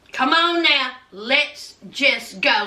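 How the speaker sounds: measured, word-by-word insistence; slow, firm urging. Retold or imitated. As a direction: repeat it slowly and firmly in full voice. Retold urging repeated slower, firmer.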